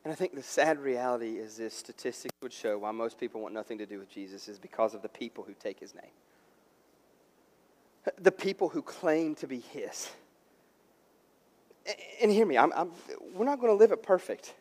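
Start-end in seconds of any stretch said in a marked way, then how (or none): 0.0-10.1 low, very soft preaching; hushed, sober admission. Real, not acted. Pastor admitting believers put people off Jesus, hushed and sober. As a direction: drop right down to a grave, quiet confession. Pastor confides a sad reality.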